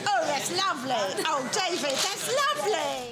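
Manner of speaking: gushing mock-Cockney darling; put-on Cockney accent. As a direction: lay on a hammed-up Cockney. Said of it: "talking now with Cockney accents".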